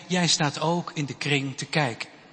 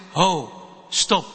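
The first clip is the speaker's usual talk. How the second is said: a lightly voiced heavier teacher's voice; a hint of an adult stepping in firmly. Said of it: "een zwaardere stem achter je zegt". (iets zwaardere stem van de meester, rustig maar beslist)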